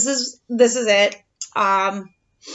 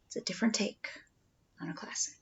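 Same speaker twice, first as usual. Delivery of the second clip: whisper; 3 semitones lower, 13 dB quieter, faster than usual. Real, not acted. Parent whispering during book intro.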